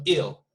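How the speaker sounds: "ill" with the vowel of sick; short I vowel. Shown as a demonstration. Teacher summing up the difference for students, short-vowelled "ill". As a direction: say "ill" with no eye glide. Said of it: "It's a little bit different".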